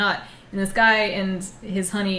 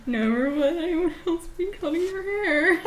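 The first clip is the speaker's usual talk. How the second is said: high, slow, laughing mock Italian; laughing imitation; 9 semitones higher, slower than usual. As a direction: repeat the line through laughter in a squeaky mock accent. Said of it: "mocking Chad's Franco line".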